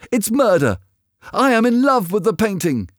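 high, impassioned outcry; shouting. A narrator's reading. Dorian defending his portrait from the knife, shouting. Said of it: "he shouted".

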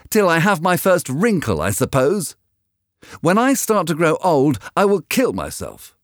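high-pitched bitter outburst; angry. A narrator's reading. Dorian bitterly reproaching the painter about growing old, angry. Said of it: "Dorian seemed quite angry".

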